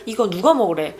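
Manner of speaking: 누가 punched as the question word; stress on 누가. Shown as a demonstration. Admonishing someone eating without permission, stress on 누가. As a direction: scold with the weight on 누가, "who?". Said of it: "that's a way of admonishing someone".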